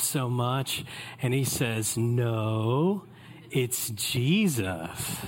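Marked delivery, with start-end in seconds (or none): 2.0-5.2 put-on slow, lower pious little boy; angelic kid voice. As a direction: play the goody-goody cousin. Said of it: "he put on this fake angelic face".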